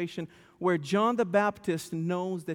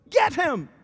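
higher and louder command; shout; 7 semitones higher, 6 dB louder than usual. Retold or imitated. Wesley calling his son to chase the thief, shouting. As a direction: bark it after the thief.

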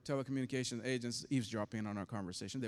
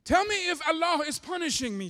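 much higher, louder desperate scream; retold screaming; 14 semitones higher, 10 dB louder than usual. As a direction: cry it out as if to the heavens. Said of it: "She's, she's screaming top of her lungs".

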